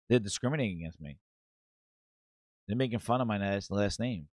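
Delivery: low, hushed grievance; soft complaint. Retold or imitated. Booty tells HR they mock his name, soft complaint. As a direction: play the quiet victim, low and soft. Employee's victim act to HR.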